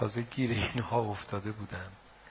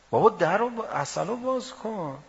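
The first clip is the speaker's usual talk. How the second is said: loud, rising rhetorical preaching; fiery pulpit challenge, much louder; preaching at full force; 6 semitones higher, 9 dB louder than usual. Acted. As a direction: hammer each question at the crowd. Fiery argument to the crowd.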